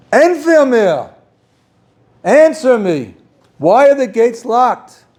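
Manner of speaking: high, loud, slow demanding cry; frantic demand. Retold or imitated. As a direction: shout it at the rabbi. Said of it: "He's agitated, he's frantic".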